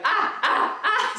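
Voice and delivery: laughing imitation of a baby yelling; loud breathless mock shouts. Mom imitating her baby daughter yelling, a laughing mock yell. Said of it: "She's like".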